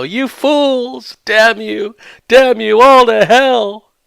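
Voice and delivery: high, loud hammed-up Heston despair; theatrical movie-star rage; Charlton Heston impression. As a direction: bellow it like a stranded astronaut cursing mankind. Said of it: "Charlton Heston, when he sees the Statue".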